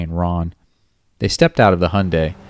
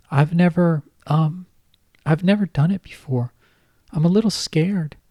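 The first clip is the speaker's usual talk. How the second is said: much higher girlish voice with a hint of a man underneath; a grown man faking a little girl, pitched way up; babyish high voice; 11 semitones higher than usual. Kyle faking Kate's voice on the phone to Ron, high and babyish. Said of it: "his Cuddly Kate voice holding".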